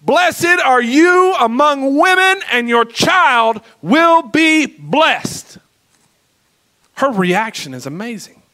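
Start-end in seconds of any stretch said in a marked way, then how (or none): 0.0-5.6 high, slow, ringing proclamation; loud, slow, joyful cry of blessing; loud exclaiming cry. Acted. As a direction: call out the blessing with full joy. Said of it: "she exclaimed with a loud cry".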